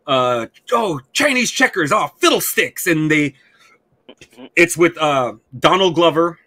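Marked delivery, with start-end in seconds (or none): 0.7-2.9 high, prissy mock-innocent voice; goofy kid detective. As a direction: send up a goody-goody boy detective, pitched up. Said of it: "they fucking say".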